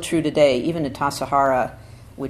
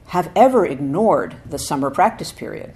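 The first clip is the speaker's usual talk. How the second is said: louder, emphatic recitation, rising at the start; solemn scripture reading; 6 dB louder than usual. Acted. Teacher reading Dogen's essay to students, emphatic. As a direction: proclaim the old text with weight.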